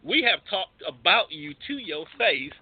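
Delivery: normal talking voice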